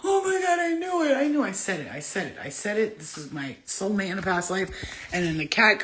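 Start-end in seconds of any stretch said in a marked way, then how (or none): 0.1-1.4 much higher, shouted joy; excited shout. Retold or imitated. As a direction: cry out as if just waking to the truth. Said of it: "I woke up and I was like".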